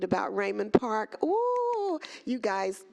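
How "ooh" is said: high, drawn-out delighted "ooh"; real thrilled "ooh", pitched way up; excited ooh. Real, not acted. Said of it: "I'm just so excited".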